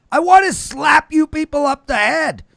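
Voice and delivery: loud, high, exasperated outburst; exasperated shout. Real, not acted. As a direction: yell it in frustration. Exasperated host scolding the audience.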